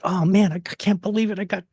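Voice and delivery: high giddy disbelief; excited self-quote. Retold or imitated. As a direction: gush like you can't believe your luck. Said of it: "I was all like".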